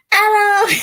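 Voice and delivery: high, loud mock call to the duck; mock excited shout of the duck's name; imitated call. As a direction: yell the pet's name like the TikTok guy. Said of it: "He's like".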